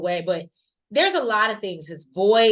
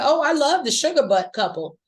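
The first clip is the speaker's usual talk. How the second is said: gushing excitedly; 5 semitones higher, 4 dB louder than usual. Retold or imitated. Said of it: "I said".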